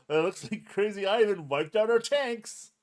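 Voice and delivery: high, loud play-by-play of a defeat; mock battle report. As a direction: announce the losses like an excited commentator. Re-enacting the dice roll announcement.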